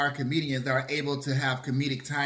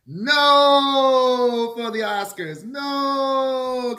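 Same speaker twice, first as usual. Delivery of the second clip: drawn-out shouted no; loud protest; 9 semitones higher, 7 dB louder, much slower than usual. Real, not acted. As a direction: hold out a loud wailing no. Ranting against Kevin hosting.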